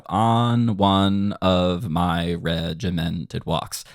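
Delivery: very slow, measured lyric recitation; recited in rhythm. Shown as a demonstration. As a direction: stretch each word out evenly to a beat. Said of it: "play off of the rules you're creating".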